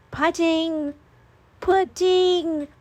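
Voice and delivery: put-on high, excited child squeals. A narrator's reading. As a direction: squeal it like an eager little girl. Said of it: "Tabby said excitedly".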